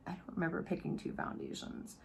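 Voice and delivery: barely voiced aside to self; very soft, unvoiced-sounding musing; puzzled whisper. Real, not acted.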